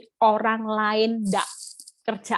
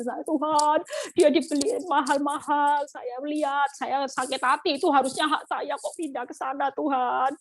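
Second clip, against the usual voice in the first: higher-pitched mock crying plea to God; retold tearful complaint; 4 semitones higher than usual. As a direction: act out wailing to God over the hurt, voice lifted. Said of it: "Waktu itu saya nangis". (menirukan tangisan mengadu kepada Tuhan)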